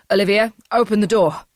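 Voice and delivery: loud, commanding shout through a door. Acted. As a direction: yell it from the other side of the door. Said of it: "her dad shouted from outside".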